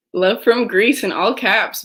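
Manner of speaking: loud, slow read-out; excited reading. Real, not acted. Host reads the Greek viewer's all-caps love, loudly. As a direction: boom the comment to match the caps.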